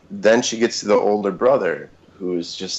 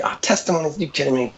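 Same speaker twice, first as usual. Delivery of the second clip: scoffing disbelief; 9 semitones higher, 4 dB quieter, faster than usual. Retold or imitated. Scoffing at testimony night.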